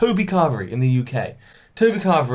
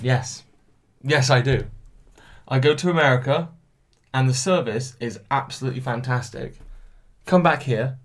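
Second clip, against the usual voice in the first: ordinary delivery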